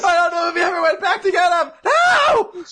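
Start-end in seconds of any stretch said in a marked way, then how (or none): 1.8-2.6 very high, loud wail; comic anguished shout. Acted. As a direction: cry it out to the sky. Wailing Jocko voice.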